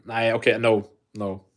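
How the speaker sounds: ordinary talk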